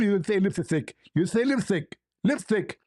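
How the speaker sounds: lipstick said as lipsthick, the s lisped into th; mock the caller saying lipstick with a lisped th, lipsthick; mocking accent. Mocking the caller's lipsthick before telling him to learn English. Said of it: "Learn English".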